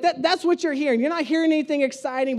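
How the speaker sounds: usual speaking voice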